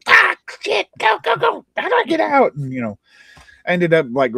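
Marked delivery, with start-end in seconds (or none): 0.1-2.5 frantic high-pitched shout; panicked yelling. Retold or imitated. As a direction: shriek it like you're about to die in game. Retold in-game panic, being shot.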